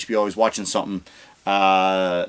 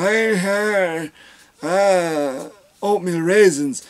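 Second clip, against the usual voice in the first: put-on higher, slow confused old man; mock Biden; 6 semitones higher, much slower than usual. Biden answering the question, mock confused. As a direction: mumble like a lost old man. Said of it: "Biden just went".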